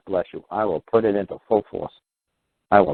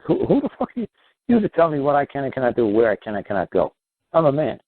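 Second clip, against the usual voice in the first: defiant outburst; 7 semitones higher than usual. Pretend. Defying the state in retold confrontation.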